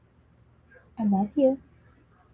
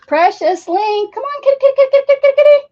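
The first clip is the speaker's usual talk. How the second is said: high calling that swells ever louder; kitty call; 9 dB louder, faster than usual. Real, not acted. Owner calling kitty kitty, rising to a loud high call. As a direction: call the cats, louder each time.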